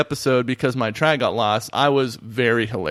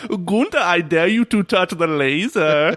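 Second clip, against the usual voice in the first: slow, higher mock German accent; 3 semitones higher than usual. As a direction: lay on a hammy German accent. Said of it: "one line of that sweet German impression of yours".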